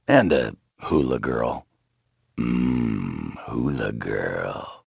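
slow, soft, lost in a daydream; lazy wistful hum of longing; dreamy murmur. Acted. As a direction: savour the words like a fantasy. Daydreaming about downtime.

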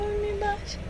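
usual speaking voice

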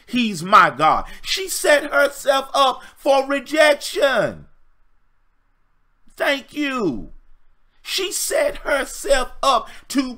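high, loud, building to a shout; preaching at full force. Acted. Pastor preaching to ladies, rising to a fervent shout. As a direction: preach it like a revival crescendo.

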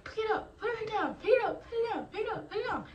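high mock instructor orders; drill instructor command. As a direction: repeat the commands like a drill sergeant. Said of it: "Just like".

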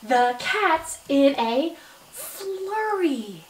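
"The cat's in a flurry" read very slowly word by word. Shown as a demonstration. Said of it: "Let's read that sentence again".

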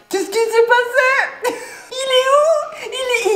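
high, slow laughing disbelief; laugh-crying where's my parcel; laughing panic. Real, not acted. Colis disparu, panique rieuse. (panique rieuse, voix aiguë et lente)

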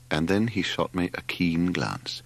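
plain reading voice

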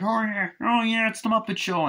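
very high put-on puppet voice; excited Muppet-show voice. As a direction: squeal it like a TV puppet.

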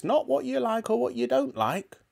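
put-on high, scolding old-woman voice; snappish grandmother. A narrator's reading. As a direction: snap at the boy as a strict granny. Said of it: "Grandma snapped".

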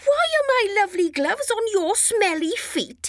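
high, indignant little voice; high and outraged at the gloves. A narrator's reading. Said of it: "he said".

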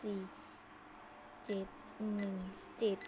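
usual speaking voice

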